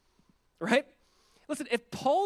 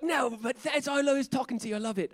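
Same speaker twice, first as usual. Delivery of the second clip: high-pitched throaty Australian neighbor impression; Australian throat voice; 6 semitones higher than usual. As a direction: mimic an Aussie bloke talking from his throat. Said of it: "he had, like, a throat voice".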